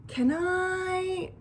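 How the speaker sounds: high, drawn-out request; hopeful plea. A narrator's reading. Bat wanting so badly to hold the kit, pleading.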